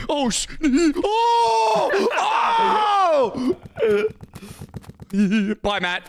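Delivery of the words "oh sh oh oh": panicked shout. Real, not acted. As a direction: yell out in alarm. Getting hit in the game.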